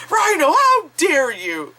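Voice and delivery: high, stretched-out mock scolding; indignant kid impression. Kid parroting mom or dad at Rhino, indignant kid impression. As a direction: copy a kid parroting a parent's telling-off. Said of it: "he was just like".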